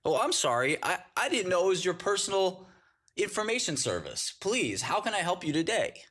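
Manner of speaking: high, sarcastic customer-service voice; mock a too-sweet help desk.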